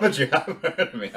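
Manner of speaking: playful British accent movie impression; Johnny Depp pirate. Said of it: "Johnny Depp's role in Pirates of the Caribbean".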